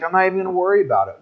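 rushed, casual dismissal; breezy brush-off. Retold or imitated. Paul shaking off the venomous snake, fast and carefree. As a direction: rattle it off like it's nothing. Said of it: "he's like".

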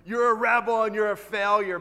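loud, punchy pretend daily reminder; mock proclamation. Reading the fridge note before work, loud mock proclamation.

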